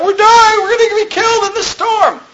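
very high, hammed-up wail; exaggerated high-pitched hysterics; mock panic. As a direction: imitate frightened men crying out in a storm. Imitates disciples panicking in the storm.

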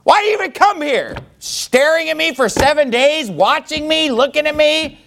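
high-pitched angry yelling; exasperated, high shouted rant; mad outburst. Acted. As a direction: yell at them to leave, outraged. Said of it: "he's just mad".

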